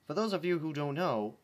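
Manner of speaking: plain reading voice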